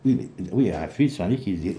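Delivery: ordinary delivery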